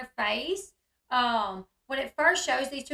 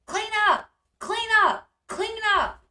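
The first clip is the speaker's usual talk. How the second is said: high, loud repeated order; imitated yelling; 8 semitones higher, 6 dB louder than usual. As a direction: mimic her yelling orders at children. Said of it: "She yells at them".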